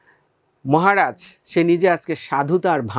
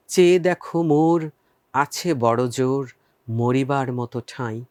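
poem recitation; 2 semitones lower than usual. Shown as a demonstration. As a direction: recite the poem slowly, savouring each line. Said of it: "আমি একবার তোমাদেরকে আবৃত্তি করে শোনাচ্ছি".

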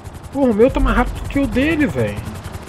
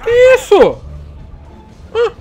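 loud high startled cry; shocked yell; 7 dB louder than usual. Real, not acted. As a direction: blurt it out in shock. Sudden shock mid-fight.

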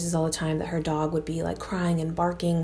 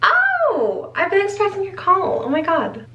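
squealing high mock reply, far louder; hammed-up high voice; 14 semitones higher, 5 dB louder than usual. Host answering the cursed caller in a squeal. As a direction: play the thrilled victim, way up high. Mock phone call skit.

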